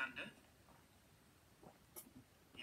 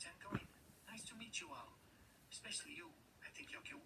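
whisper; 8 dB quieter than usual. Acted. Camel flirting goodbye.

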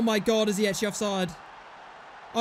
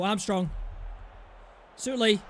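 ordinary delivery

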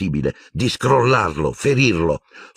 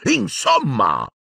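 put-on high, slow, outraged old portrait; drawn-out scandalised cry; indignant Dippet; 10 semitones higher, much slower than usual. A narrator's reading. Portrait protesting the smashed instrument, high and outraged. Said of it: "quello di Armando Dippet esclamò".

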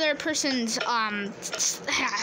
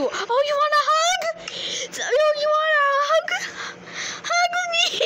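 put-on high, cooing baby-talk voice; mock cooing; 10 semitones higher than usual. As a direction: coo at it like a pet. Teasing the animatronic.

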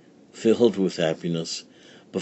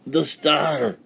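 higher, awed cry; excited cry; 7 semitones higher than usual. Acted. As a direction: gasp it out in wonder. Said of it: "cried the wise man".

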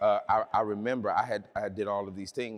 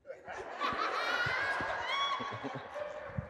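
high shrieking laugh. Real, not acted. Preacher laughing at her brother's words, high shriek of a laugh.

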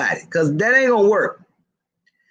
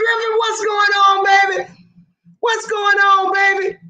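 mock Atlanta greeting; 10 semitones higher than usual. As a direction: shout a welcome like a flirty local, laughing. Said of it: "they gonna tell you".

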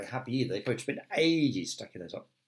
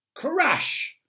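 put-on high, bright "crash!" for the kids; crash sound effect; 4 dB louder than usual. A narrator's reading. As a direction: make the crash big and playful. Lamp falls over.

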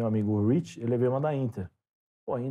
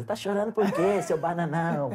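higher, teasing voice of the wife; mocking wife; 7 semitones higher than usual. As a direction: poke fun at the crying husband. Wife teases him for crying. (esposa zombando do marido chorão, voz mais aguda)

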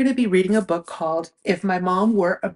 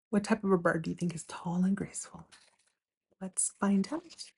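whispered question; 8 dB quieter than usual. Shown as a demonstration. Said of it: "asks the children".

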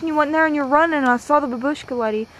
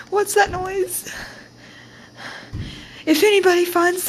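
frightened; 6 semitones higher than usual. Acted. Alone, scared, pleading.

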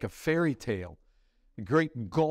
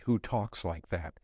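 low, subdued, pondering question; sober reflection; 9 semitones lower than usual. Real, not acted. Preacher turning the scoffer's question on believers, reflective.

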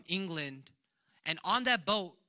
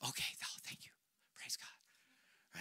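softer breathy thanks, barely voiced; relieved whisper; 7 semitones lower, 17 dB quieter than usual. Relieved reply to wife.

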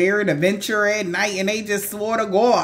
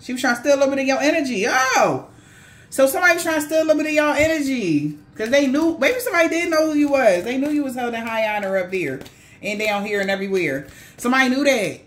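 excited; 6 semitones higher, faster than usual. Real, not acted. Excited reveal of the attack.

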